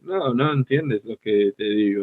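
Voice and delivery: level, monotone Spanish; easy, understated Spanish delivery; flat, low-energy Spanish. Shown as a demonstration. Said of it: "it's much easier".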